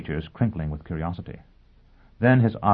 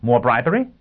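raised, rising question; incredulous query; 6 semitones higher, 7 dB louder than usual. Acted. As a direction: throw it back higher, in disbelief. Lawyer throws the word back.

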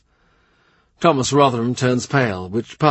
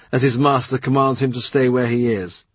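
plain reading voice

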